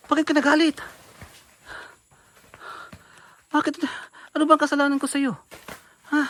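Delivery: high, loud protest; loud hurt protest; upset shouting. Real, not acted. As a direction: yell why are you angry. Being hit, shouting back.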